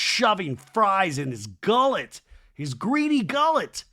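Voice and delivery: loud, drawn-out scornful exclamation; hammed-up disgust. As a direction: swoop high and hit each gullet hard. Host scorns Jesse gorging.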